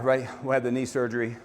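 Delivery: ordinary talk, nothing marked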